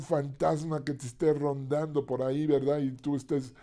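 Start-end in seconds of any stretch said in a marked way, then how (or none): none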